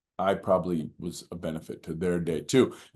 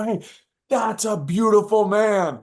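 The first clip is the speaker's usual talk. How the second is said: high, loud, drawn-out holler; acted cheering shout from a car window; retold yell; 13 semitones higher, 6 dB louder, slower than usual. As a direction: call it slowly across a busy street. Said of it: "I yell across the street".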